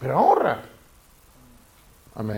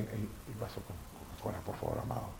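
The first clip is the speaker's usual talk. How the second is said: much softer, offhand request; quiet aside; 4 semitones lower, 16 dB quieter than usual. Real, not acted. Preacher quietly asking a helper for his Coca-Cola, softly. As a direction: drop the voice for a quick side request.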